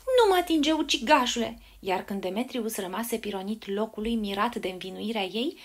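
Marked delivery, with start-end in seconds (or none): 0.1-1.6 țipăt de poveste, pornit înalt și tare; respinge-l cu un strigăt ascuțit, apoi coboară. A narrator's reading. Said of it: "dar Hermia țipă". (strigăt acuzator jucat)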